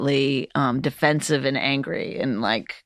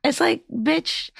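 high, slow drawn-out scorn; exasperated; 11 semitones higher, slower than usual. Retold or imitated. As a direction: let the scorn hang. Annoyed at heckler.

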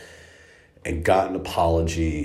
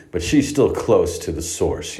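ordinary talk

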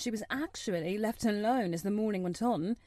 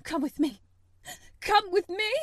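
high, loud urgent cry building; frantic call; 10 semitones higher, 6 dB louder than usual. Acted. Mother calling the officer to follow, rising to a shriek. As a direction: shout it as she runs in panic. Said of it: "the Mem Sahib cried".

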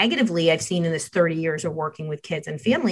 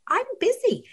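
breezy brush-off; 4 dB quieter than usual. Retold or imitated. Mom brushing son off.

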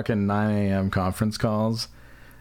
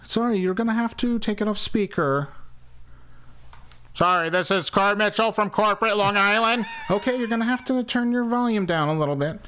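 high, nasal-free mock moderator; nagging call moderator voice; 11 semitones higher than usual. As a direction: pretend to be the conference host asking again. Mock conference call exchange.

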